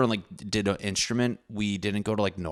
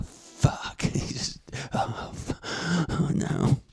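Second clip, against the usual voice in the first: whispered mock dismay; 9 semitones higher, slower than usual.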